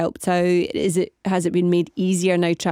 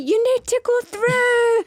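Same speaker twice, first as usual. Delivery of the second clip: high-pitched quoted voice; slower than usual. Retold or imitated. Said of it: "someone's like".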